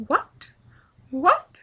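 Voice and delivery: rising to a high, loud yelled What?; David Tennant's what. Retold or imitated. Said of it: "He says it louder and louder and louder".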